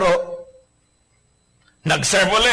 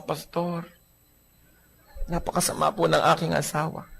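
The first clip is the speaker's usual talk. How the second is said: soft complaint; 7 dB quieter than usual. Acted. Battered wife confides to pastor.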